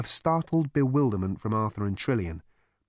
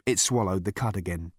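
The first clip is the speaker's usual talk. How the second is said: ordinary delivery, nothing marked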